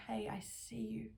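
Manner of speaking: whispered, slow and tender; soft whisper. Acted. Comforting adult whispering to a hurting child. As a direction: breathe it softly into the child's ear.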